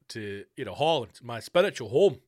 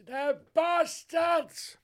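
very high, drawn-out indignant cry; mock outrage; 13 semitones higher, much slower than usual. Reacting to officials taking a ham sandwich, in high, slow mock outrage. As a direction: stretch it out in hammed-up horror.

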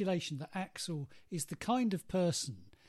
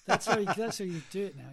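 ordinary talk, nothing marked

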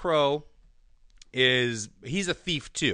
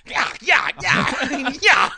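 repeated high, loud attack cries; short bursts of comic fighting noise; mock headbutt yells; 12 semitones higher, 11 dB louder, slower than usual. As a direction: yell once for each blow. Imitates six headbutts with yells.

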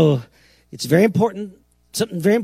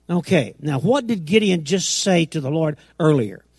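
ordinary delivery

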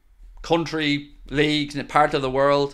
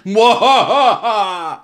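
gleeful laugh; 4 semitones higher, 6 dB louder than usual. Real, not acted. Laughing at rival.

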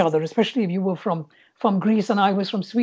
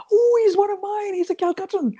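retold excitement in a much higher pitch; reliving a thrilled realisation, voice leaping up high; excited recognition; 13 semitones higher than usual. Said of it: "getting very excited".

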